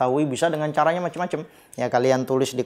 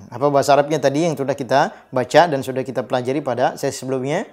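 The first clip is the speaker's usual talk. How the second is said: normal talking voice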